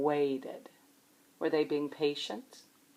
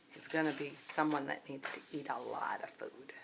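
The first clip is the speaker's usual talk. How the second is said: whisper; 5 semitones lower, 12 dB quieter than usual. Acted. Aside to children about Junior.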